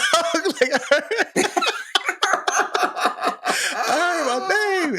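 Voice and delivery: high laughing squeal; words squeezed out through laughter. Real, not acted. Laughing while recalling his name called.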